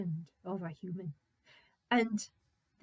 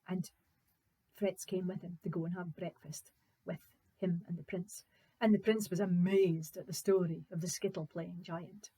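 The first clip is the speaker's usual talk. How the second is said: usual speaking voice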